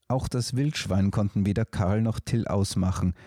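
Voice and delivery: usual speaking voice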